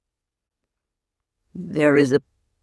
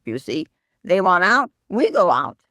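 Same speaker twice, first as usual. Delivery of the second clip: normal talking voice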